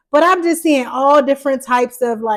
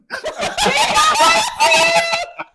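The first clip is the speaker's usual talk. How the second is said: shrieking laugh, way up high; squealing laugh at the joke. Real, not acted.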